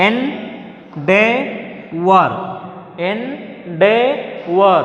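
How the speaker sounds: "endeavor" in three very slow, separate syllables; teacher sounding out "endeavor" piece by piece. Shown as a demonstration. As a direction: leave clear gaps inside "endeavor", one part at a time. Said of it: "There are three syllables".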